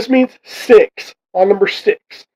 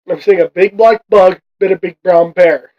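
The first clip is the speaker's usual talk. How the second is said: normal talking voice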